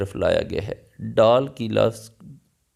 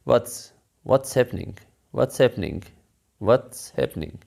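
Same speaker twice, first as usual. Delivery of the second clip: slow separated words; much slower than usual. Shown as a demonstration. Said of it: "पहले आप अल्फाज को अलग-अलग बोलें".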